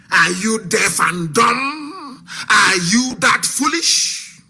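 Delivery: ordinary delivery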